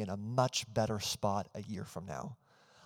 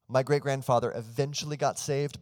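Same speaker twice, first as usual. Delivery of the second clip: slightly raised and louder, eventually pressed home; stress on eventually; 3 semitones higher, 4 dB louder than usual. Real, not acted. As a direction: lean hard into the word eventually. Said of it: "I really want to stress the word eventually".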